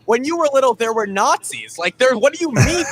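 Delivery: laughing at the comparison in disbelief; incredulous retort. Retold or imitated. Grandson answering grandpa, high and laughing in disbelief. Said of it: "And I'm like".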